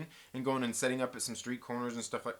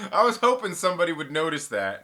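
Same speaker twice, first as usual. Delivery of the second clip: louder, pleased announcement; loud proud claim; 4 semitones higher, 7 dB louder than usual. Real, not acted. Streamer admits to chat he wanted them to notice, loudly. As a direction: call it out to the chat.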